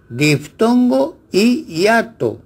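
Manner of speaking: "diptongo y hiato" con la "y" alargada y aguda; correct reading. Shown as a demonstration. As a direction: marca la "y" de "diptongo y hiato" como sonido i. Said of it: "Lo correcto es representar el fonema". (pronunciación correcta con y)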